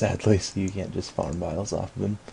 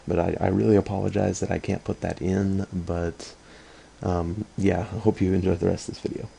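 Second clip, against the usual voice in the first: normal talking voice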